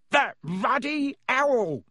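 angry uncle. Acted. Uncle Vernon thunders from bedroom.

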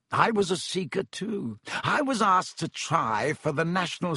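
Lockhart voice. Acted. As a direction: savour the title vainly. Lockhart boasting.

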